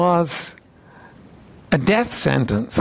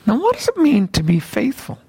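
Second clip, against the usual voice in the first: usual speaking voice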